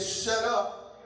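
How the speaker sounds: a firm, stern shut up. Acted. Jesus silencing the spirit, sternly. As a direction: cut them off sternly.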